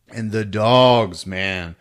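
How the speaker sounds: normal talking voice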